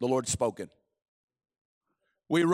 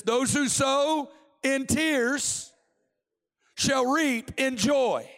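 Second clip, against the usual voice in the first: slow, raised preacher's voice; fervent, drawn-out promise to the congregation; scripture proclamation; 4 semitones higher, slower than usual. Real, not acted. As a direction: declaim the verse with weighty pauses. Declaims scripture.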